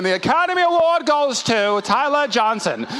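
high, grand mock announcement; award announcer. Speaker awarding himself for his acting, as a mock presenter. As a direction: announce the winner with hammed-up ceremony.

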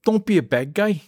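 high put-on Italian New York accent; fake Sicilian accent. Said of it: "Something like that".

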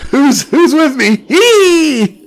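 very high, slow squeaky voice, laughing; squeaky mini voice, giggling. Pretend. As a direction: keep the tiny voice while cracking up. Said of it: "It was a battle cry".

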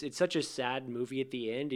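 ordinary talk, nothing marked

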